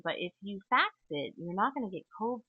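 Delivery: ordinary delivery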